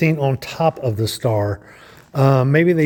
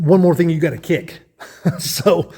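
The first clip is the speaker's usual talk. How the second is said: laughing; 5 semitones higher than usual. Real, not acted. Joking about kicking the holder.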